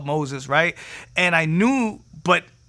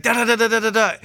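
higher, louder rapid syllables; imitating tongues; 7 semitones higher, 5 dB louder, faster than usual. Leader praying with the kids, imitated tongues. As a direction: babble a string of syllables as mimed tongues. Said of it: "I just started speaking in tongues".

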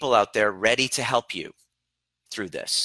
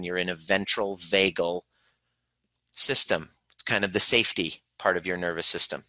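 normal talking voice

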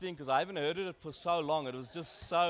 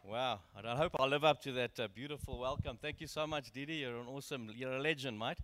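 ordinary talk, nothing marked

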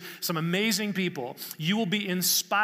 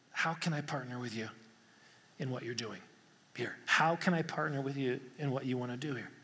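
softer, lower, inward asking; quiet prayer; 4 semitones lower, 6 dB quieter than usual. Acted. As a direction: pray it softly under your breath. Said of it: "asking the Holy Spirit".

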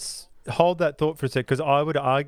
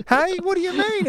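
bewildered father, voice pitched way up; high-pitched bewildered dad. Retold or imitated. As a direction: play the confused dad in a high squeak. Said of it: "and he goes".

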